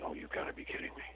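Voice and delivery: exasperated whisper; hushed, voiceless disbelief. Retold or imitated. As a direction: breathe it out in disbelief. Reaction to the unhelpful parrot.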